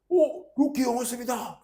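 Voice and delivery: put-on high, slow delighted call of a kid; excited kids spotting teacher. Kids spotting their academy teacher, excited high call. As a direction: sing out the teacher's name like a thrilled student. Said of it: "딱 그러는 거야".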